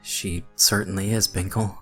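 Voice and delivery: understated shaky reply; shaking near-whisper. A narrator's reading. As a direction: answer the monster with a touch of fear. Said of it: "my shaking voice barely a whisper".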